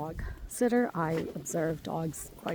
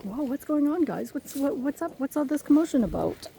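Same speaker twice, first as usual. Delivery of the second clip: lightly voiced excited dog, a bit higher; a hint of a nosy dog; speaking dog; 6 semitones higher than usual. Dog sitter voicing excited Tucker, a bit higher. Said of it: "that's me speaking dog again".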